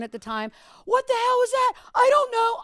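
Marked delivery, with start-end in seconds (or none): 0.8-1.7 high, loud shocked shout; boss yelling. Retold or imitated. Program director storming in after the on-air curse, yelling. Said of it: "The program director ... comes in". 1.9-2.6 high, loud, panicked; panicked reply. Retold or imitated. Young DJ answering the furious boss, panicked. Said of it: "I'm crying".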